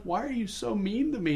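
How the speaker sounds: small high-pitched hurt voice; mock breakdown. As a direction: imitate a man pleading pitifully. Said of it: "while he's breaking down and stuff".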